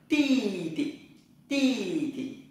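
ti tị said high, crisp and repeated; Chinese tone drill. Shown as a demonstration. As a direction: drill ti tị for the class. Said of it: "Em trai".

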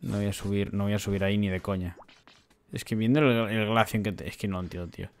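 low, very soft grumble to self; quiet, low-pitched disbelief; baffled muttering. Real, not acted. Baffled player talking to himself.

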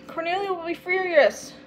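fretting about trouble to come; worried dread. A narrator's reading.

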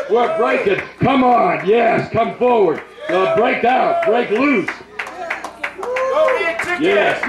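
rally them forward like a revival preacher; preaching at full force. Real, not acted.

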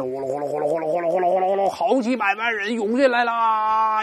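mimicked crowd rush. Teacher acting out tourists flooding the palace, mimicked crowd rush. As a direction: imitate a stampede pouring through the gates.

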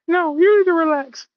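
very high, loud gremlin retort; hammed-up indignant little gremlin; Gizmo. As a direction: snap back in a squeaky creature voice. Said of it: "Little motherfucking gremlin said".

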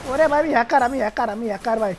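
loud, thrilled bragging; excited shout. Real, not acted. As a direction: yell it out in triumph. Boasting after a headshot. (উত্তেজিত চিৎকার)

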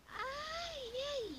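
high-pitched, drawn "Ah, yay!" of praise; cheering. Real, not acted. Cheering child's correct answer.

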